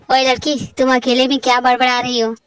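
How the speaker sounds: a mafia king warning his sister; menacing mafia boss. Acted. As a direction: lean in like a gangster. Mafia voice again.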